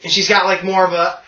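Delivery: normal talking voice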